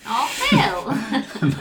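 laughing mock outrage; laughing protest. Real, not acted. Mock outrage at his joke.

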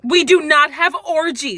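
loud flustered denial; indignant shout. Acted. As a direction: shout it, scandalized. Flustered denial of sex cult.

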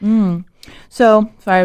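talking through a mouthful; words round a bite of food; mouth full. Real, not acted. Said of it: "I've got my mouth full".

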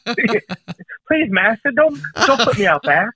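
high, pleading servile voice; hunchback assistant. Pretend. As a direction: beg the master like a cowering servant. Joke servant begging master.

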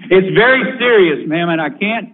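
ordinary delivery, nothing marked

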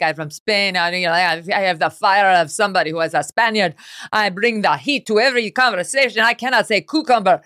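hammed-up fake Spanish accent, building in intensity; mock exotic Spanish accent. Host imitating the woman posing as Spanish, mock Spanish accent. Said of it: "like, exotic".

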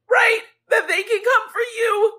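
pleading outcry that they can come for you too; anguished cry. Real, not acted.

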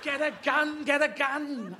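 high, urgent talking-dog voice; excitable dog. Acted. Dog urging owner to arm, high insistence. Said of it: "as the character of the dog".

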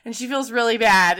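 ordinary talk

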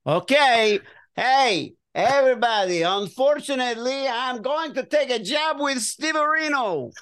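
put-on high, excited Italian accent. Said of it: "with the great Italian accent you have".

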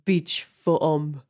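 slow, breathy fake French perfume ad; sultry perfume ad. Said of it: "this fucking shitty fake French accent".

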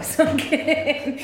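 laughing through the words; a giggly admission she's joking. Real, not acted. Joking with the host, laughing. Laughs at her own joke.

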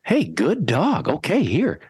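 high, slow, happy praise for the dog; cheery praise. Shown as a demonstration. Trainer flips from stern to praising the dog, high and cheery. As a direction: switch to sweet praise voice. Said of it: "flip the Doctor Jekyll, Mister Hyde".

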